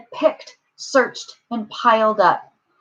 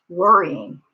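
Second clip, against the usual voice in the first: ordinary delivery, nothing marked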